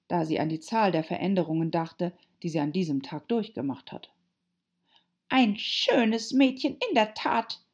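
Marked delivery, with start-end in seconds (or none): 5.3-7.6 slow, higher, scornful drawl; deep contempt. A narrator's reading. Pigeon dismissing Alice's claim, deeply contemptuous. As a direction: draw it out with disdain. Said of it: "im Ton tiefster Verachtung".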